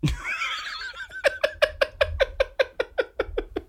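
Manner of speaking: squealing high laugh; high burst of laughter. Real, not acted. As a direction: let a high laugh break out. Laughing at own joke.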